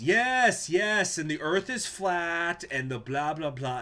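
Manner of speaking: loud, high, drawn-out mockery of a know-it-all; mocking parody. Retelling a stranger's jab, loud mocking voice. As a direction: sneer it out like a smug heckler.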